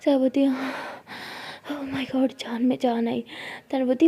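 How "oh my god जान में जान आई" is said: breathless relieved whisper. Acted. Sara whispering relief to Tara. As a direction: sigh it out in a hush.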